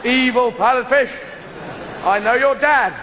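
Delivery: loud, high, alarmed yelling at oncoming lights; panicked fish shouting. Pretend. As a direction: scream it as a fish that thinks a car is coming. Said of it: "and other fish are going".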